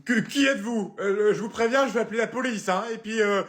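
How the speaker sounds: high, loud panicky threats; scared mother. Acted. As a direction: bluff bravely while panicking. Mère terrifiée face à l'intrus. (mère paniquée, aiguë et forte)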